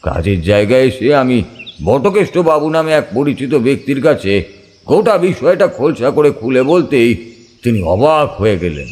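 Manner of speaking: plain reading voice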